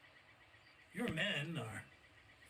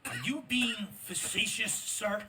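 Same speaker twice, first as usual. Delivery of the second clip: higher, louder indignant question; sharp challenge; 6 semitones higher, 6 dB louder than usual. Acted. Colonel confronts the captain, higher, louder and affronted. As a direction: demand an answer, affronted.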